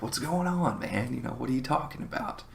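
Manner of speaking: baffled questioning. Retold or imitated. Newly arrived friends to their scared buddy, baffled questioning. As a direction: ask it in mild bewilderment.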